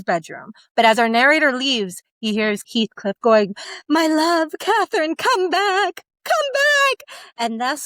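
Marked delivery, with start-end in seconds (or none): 3.9-6.0 longing plea. Acted. Heathcliff pining for dead lover. 6.2-7.2 anguished wail. Acted. Heathcliff's wail, rising further.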